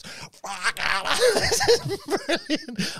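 very high, slow, amazed voice; mock amazed friend. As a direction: squeak it out in wonder.

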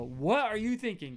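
high-pitched incredulous rebuke; acted disbelief, pitched well up; exasperated scolding. Paul rebuking the foolish Galatians, exasperated. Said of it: "it was more like".

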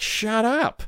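a mocking, high-pitched "shut up", tossed off; lightly mocked rude retort; rude brush-off. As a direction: snap it like you're dismissing them. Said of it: "it would be, it would be like".

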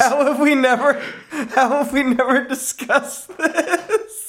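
very high, incredulous squeal with laughter; laughing disbelief. Real, not acted. Co-host shocked this never came up, high laughing disbelief. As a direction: pitch it way up, astonished and laughing.

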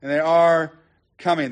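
"are" stressed, then a pause before "coming"; emphatic "are" with a held gap after it. Shown as a demonstration. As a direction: hang on "are", then let "coming" follow. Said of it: "and are is active".

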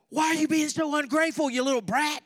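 high, loud, fast mock scolding; hammed-up furious dad in the car; mock angry parent. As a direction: yell at the kids like a fed-up dad. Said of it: "that's what you want to say".

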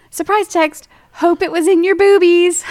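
cheeky text voice. Retold or imitated.

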